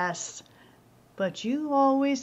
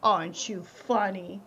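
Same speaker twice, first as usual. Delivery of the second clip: normal talking voice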